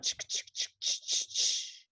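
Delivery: quick chain of voiceless ch puffs; train chugging. Shown as a demonstration. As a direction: chug "ch, ch, ch" like a train. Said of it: "it makes a train sound".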